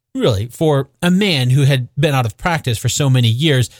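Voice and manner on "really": usual speaking voice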